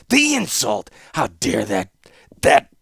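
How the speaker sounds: loud high outrage, stumbling over the word; sputtering rage. A narrator's reading. Warlord fuming at his court, sputtering angrily. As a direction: choke on your fury.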